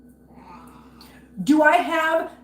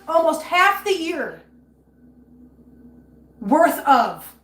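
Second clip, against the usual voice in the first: loud, tearful outrage; angry rant; 5 semitones higher, 6 dB louder, slower than usual. Real, not acted. Streamer furious at viewers about pet fees, loud and tearful. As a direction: pitch up, voice cracking with anger. Ranting about losing money for cats.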